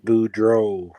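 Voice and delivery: Boudreaux said slowly as "boo-DROH"; name pronunciation demo. Shown as a demonstration. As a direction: model the name Boudreaux with a long "oh" ending. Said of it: "pronouncing Mister Boudreaux's name wrong".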